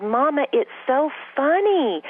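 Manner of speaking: put-on high little toddler voice calling mama. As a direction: imitate a two-year-old asking for food. Mother voices her laughing toddler.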